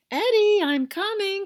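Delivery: high, loud call from afar. A narrator's reading. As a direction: Mom calls to Eddie as she runs back, high and loud. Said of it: "called Mom".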